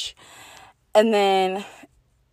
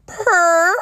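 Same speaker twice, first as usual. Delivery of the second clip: sassy purr; 11 semitones higher, 6 dB louder than usual. Real, not acted. Celebrating her own sassy text.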